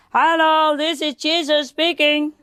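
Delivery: high, hammed-up shout from above; full shout. Prankster on the scaffolding calling down to the old woman, shouting. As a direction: bellow it down from the scaffolding. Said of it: "he shouted with all his power".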